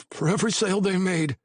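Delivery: high, anxious pleading voice; a scared informant talking fast; nervous young man. A narrator's reading. Patty voice.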